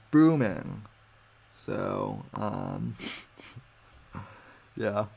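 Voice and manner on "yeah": ordinary talk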